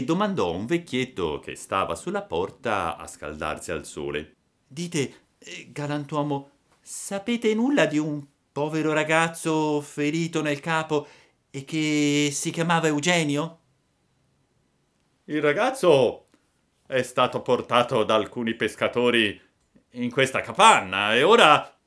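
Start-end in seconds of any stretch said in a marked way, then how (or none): 4.7-13.6 high little voice asking for news; anxious puppet. A narrator's reading. 15.3-21.8 put-on raised old-man voice giving news; little old man. A narrator's reading.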